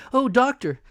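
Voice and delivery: high, loud anxious plea; calling for help; cried out. A narrator's reading. Mother and boy cry out to the doctor, high and loud. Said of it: "we cried".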